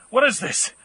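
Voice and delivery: loud, high, startled questions; startled shout. Acted. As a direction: demand it in shock. Startled by talking vultures.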